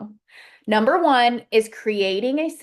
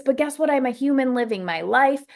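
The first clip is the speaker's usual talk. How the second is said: ordinary talk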